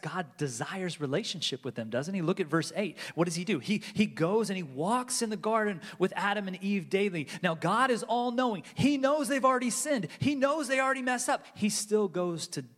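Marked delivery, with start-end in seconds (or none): 3.6-12.6 high, loud, driving sermon climax; preaching at full force. Acted. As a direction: pound home that God still comes.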